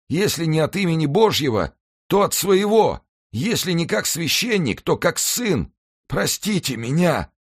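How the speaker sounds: very high, anguished supplication; desperate pleading. A narrator's reading. Dying executioner implores the victim's son, high and desperate. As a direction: implore mercy, voice pitched far up. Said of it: "шептал он".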